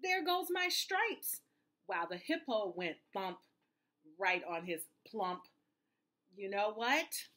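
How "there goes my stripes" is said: high, drawn-out alarmed yelp; yell. A narrator's reading. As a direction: wail it as the startled zebra. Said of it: "The zebra yelled".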